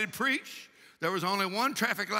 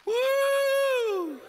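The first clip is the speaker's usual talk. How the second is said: long, high "woo!"; preacher's whoop; 6 dB louder than usual. Real, not acted. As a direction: let out a sustained, high-pitched whoop. Preacher whoops between exhortations.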